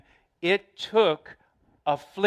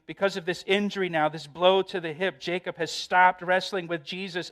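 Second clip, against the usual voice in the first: usual speaking voice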